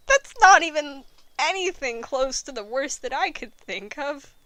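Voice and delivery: ordinary talk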